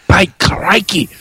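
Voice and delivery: hammed-up Australian "crikey" voice; playful Australian impression; put-on Australian accent. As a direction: switch into a mock Australian accent. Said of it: "let me change it up".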